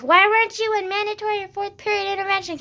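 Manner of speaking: high, loud imitation of a yelling friend; retold yell. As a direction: mimic a friend yelling in your face. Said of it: "literally yelled in my face".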